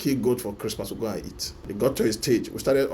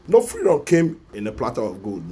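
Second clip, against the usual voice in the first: louder, slogan-like; loud declaration; 3 semitones higher, 7 dB louder than usual. Real, not acted. Vlogger rallying viewers to fight, loud proclamation. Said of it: "repeated slogan".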